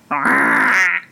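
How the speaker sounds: short rolled creature croak; Griffin's exclamation. A narrator's reading. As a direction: let out an odd croaking cry. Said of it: "an occasional exclamation of".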